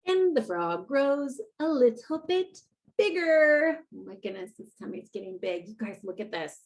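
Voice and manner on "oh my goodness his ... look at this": soft aside. Real, not acted. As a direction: drop to a confiding murmur. Aside to kids, much softer.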